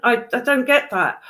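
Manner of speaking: usual speaking voice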